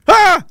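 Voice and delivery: short, high cry of horror; disgusted yelp. Acted. Recoiling from horrifying crab legs, high disgusted yelp. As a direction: yelp in revulsion, high and sudden.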